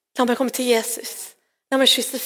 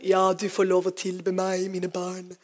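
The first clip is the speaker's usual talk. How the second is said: lower, softer, condescending grand voice; aloof mock deity; 3 semitones lower, 5 dB quieter, slower than usual. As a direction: play a distant god granting permission. Mocking a distant, aloof Jesus.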